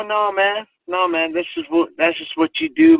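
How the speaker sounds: high, rising, pleading no man; defensive protest. Retold or imitated. Teen backpedalling to the short wrestler, high protesting voice.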